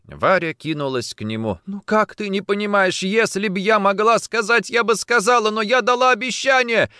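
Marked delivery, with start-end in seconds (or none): 1.7-6.9 high, loud, frantic pleading; desperate explaining. A narrator's reading. Woman rushes to him explaining her promise, desperate. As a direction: plead urgently to be understood.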